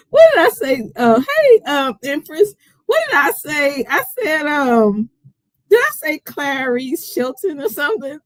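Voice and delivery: high, laughing, tipsy chatter; laughing at herself over names; giggling. Real, not acted. Tipsy host laughing at her mixed-up name for the boxer.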